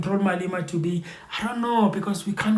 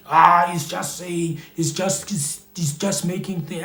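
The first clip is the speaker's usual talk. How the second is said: mock brush-off with a drawn-out "Ah"; dismissive. Listener shrugging off a person's threat about the economy, mock dismissive. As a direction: wave it away slowly, imitating a doubter. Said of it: "you must not take light".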